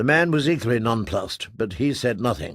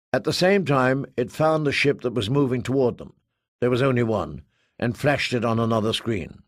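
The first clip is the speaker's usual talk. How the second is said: ordinary delivery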